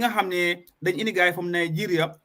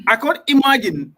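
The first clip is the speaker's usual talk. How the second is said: loud, higher-pitched exclamation of disbelief; real, raised-voice incredulity; appalled disbelief; 5 semitones higher, 7 dB louder than usual. Real, not acted. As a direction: burst out, unable to believe it. Disbelief at the violence described.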